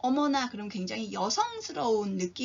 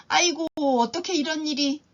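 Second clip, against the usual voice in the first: dismayed astonishment; 4 semitones higher, 5 dB louder than usual. Shown as a demonstration. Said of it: "정말 이상한 일이 생겼구나".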